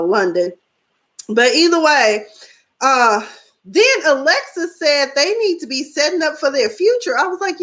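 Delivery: ordinary talk, nothing marked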